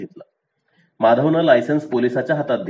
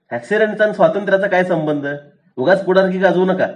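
irritated; 6 semitones higher than usual. A narrator's reading. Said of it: "police पण उचकला".

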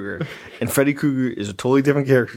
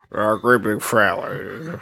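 slow, drawn-out killer impression; Buffalo Bill; slower than usual. Quoting the Silence of the Lambs killer, slow put-on voice. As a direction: stretch the words in a put-on voice. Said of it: "It's the same as Buffalo Bill".

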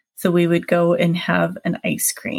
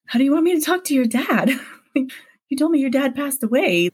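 higher, puzzled retold question; confused, pitched-up protest; baffled; 6 semitones higher, faster than usual. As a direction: reenact bewildered disbelief with raised pitch. Retells confused reply to boyfriend.

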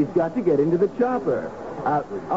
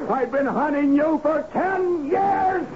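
higher, slow, shouted accusation; furious shout; 8 semitones higher, slower than usual. Acted. Clark finally facing Kruger, a furious shout. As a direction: roar it at the man you've hunted for years.

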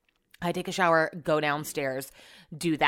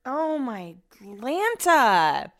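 high, very slow drawn-out groan; fed up over the drilling noise; exasperated; 6 semitones higher, much slower than usual. Real, not acted. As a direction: stretch it out in mock despair.

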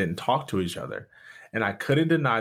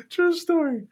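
laughing. Real, not acted. Laughing after the story.

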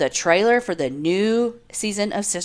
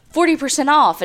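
perky sale-announcer lilt, a touch higher; mock ad voice; 7 semitones higher than usual. Mocking Prime Day ads, cheesy announcer voice. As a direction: sell the fake discount like a cheesy ad. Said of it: "and then, like".

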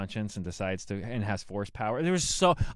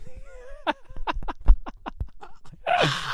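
high laugh; 4 dB louder than usual. Real, not acted. Laughing at the impression.

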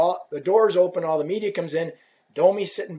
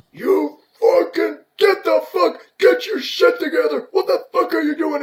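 retold angry yell; 11 semitones higher, 6 dB louder than usual. Angry player turns on the stick rep, imitated furious yelling. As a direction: imitate a player blowing up, pitch way up.

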